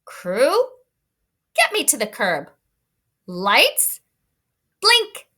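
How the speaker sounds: the truck barking orders, blink jumps high; lightly voiced truck commands. Teacher reading the truck giving orders to its crew, lightly voiced. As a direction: call out each command like a boss truck.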